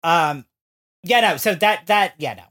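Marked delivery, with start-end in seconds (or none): none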